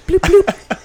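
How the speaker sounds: high chirpy bloop bloop; high bloop noise. Retold or imitated. Imitating a police light to friends, high bloop noise. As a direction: imitate the cop light flashing.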